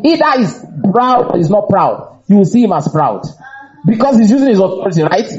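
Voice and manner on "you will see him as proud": ordinary talk, nothing marked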